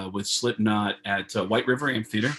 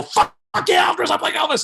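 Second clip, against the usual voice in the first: much higher and louder, delighted shout; thrilled yell; 8 dB louder, faster than usual. Retold or imitated. Retold yes to the bride about playing [name], thrilled yell. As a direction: shout yes like a dream came true.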